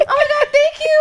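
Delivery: high, loud laughing gratitude; gushing thanks. Pretend. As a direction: gush over the tip.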